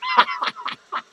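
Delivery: high-pitched cackle; high, squealing burst of laughter; cackling laugh. Acted. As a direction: cackle high at your own joke. Laughs about cheap drink.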